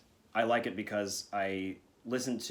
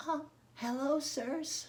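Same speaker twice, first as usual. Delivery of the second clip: put-on little boy voice; much slower than usual. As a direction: pitch it way up like a sweet little boy. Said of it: "In your best little boy voice".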